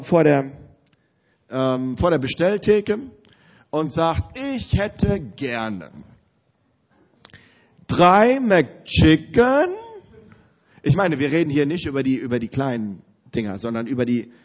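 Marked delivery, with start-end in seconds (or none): 4.4-9.7 very slow, stretched-out order, words dragged apart; mock-solemn slow-motion order; drawn-out order. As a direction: spell out the order slowly, savouring the absurdity. Retelling Markus ordering at counter.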